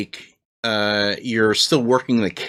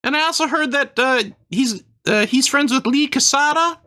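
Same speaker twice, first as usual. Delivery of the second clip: high squeaky Christmas elf; chirpy elf shopkeeper, way up high; 13 semitones higher than usual. Acted. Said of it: "I do an elf voice".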